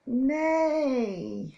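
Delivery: loud high whinnying neigh; big horse neigh. Pretend. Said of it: "A horse goes".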